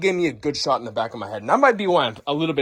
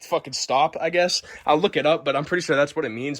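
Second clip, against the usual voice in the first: ordinary delivery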